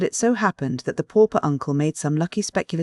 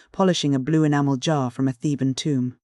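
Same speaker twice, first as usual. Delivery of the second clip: ordinary delivery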